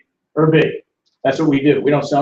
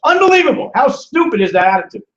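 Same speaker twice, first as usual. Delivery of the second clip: high, loud disbelief; loud, high-pitched incredulous cry; exasperated outburst; 7 semitones higher, 4 dB louder than usual. Real, not acted. Speaker exasperated at audience's attitude.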